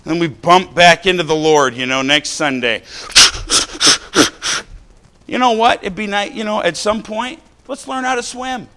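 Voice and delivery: rising sermon fervour; fervent preaching. Acted. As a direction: preach it out to the pews. Preaching to congregation.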